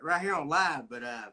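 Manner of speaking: ordinary delivery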